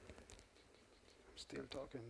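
soft, slow, breathy self-aware whisper; whispered aside. Real, not acted. Speaker caught overrunning his time, whispering to the audience. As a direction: hush it like a guilty confession.

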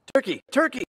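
high sing-out, coaxing call; sweetest calling voice. A narrator's reading. Mister Moose calling Turkey from the nest, sweetly. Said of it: "called in his sweetest voice".

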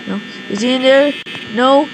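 usual speaking voice

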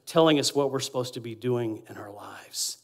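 softly whispered words. Acted. Pastor voicing the Spirit's whisper to the congregation, whispered. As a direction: breathe it like a whisper in the ear. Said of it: "Holy Spirit whispering in our ears".

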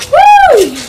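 high loud thrilled whoop; excited whoop. Real, not acted. Landing a trick.

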